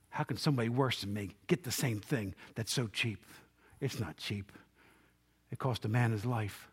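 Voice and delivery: low, soft preaching voice; quiet, sober reflection with pauses; hushed, solemn. Real, not acted. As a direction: drop right down and let each phrase sink in. Repeats it hushed, then sober reflection.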